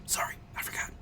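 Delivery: hushed whispered apology. Acted. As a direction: whisper it behind a hand. Covered his mouth, library quiet.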